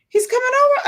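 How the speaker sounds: put-on high, thrilled child's squeal; excited little girl. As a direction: squeal like a happy toddler. Voicing excited toddler.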